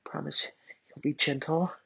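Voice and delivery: soft, high timid plea; nervous girl asking Bakugo for gentleness; small voice. Acted. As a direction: ask it tiny and unsure. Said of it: "I said in a small voice".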